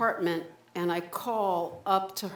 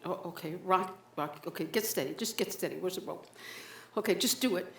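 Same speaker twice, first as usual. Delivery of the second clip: hushed self-talk; 8 dB quieter, faster than usual. Acted. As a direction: talk yourself through it under your breath. On toilet seat, hushed self-talk.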